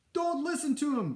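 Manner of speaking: high, loud warning to a crowd; shouting. A narrator's reading. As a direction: cry it out urgently over the crowd. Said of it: "Basil shouted to the crowd".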